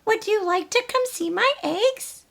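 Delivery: high, bright little hen voice; Dora the hen. Acted. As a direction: play a chirpy young hen wondering aloud. Dora asks the pig.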